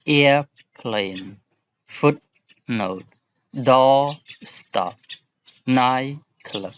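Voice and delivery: teacher modelling stress on the first word. Shown as a demonstration. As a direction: punch the first part of each compound. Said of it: "The stress is on the ear".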